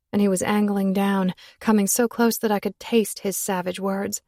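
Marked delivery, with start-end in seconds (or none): none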